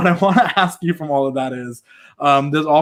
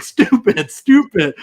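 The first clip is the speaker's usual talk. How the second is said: mock angry Kofi throwback; 7 semitones higher, 5 dB louder, much slower than usual. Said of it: "now you had Kofi being like".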